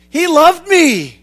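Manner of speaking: slow, loud and high, leaning hard on me; he loved me, stretched out and lifted high; emphatic testimony. Real, not acted. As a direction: testify it slowly, every word weighed. Preacher's personal testimony of love.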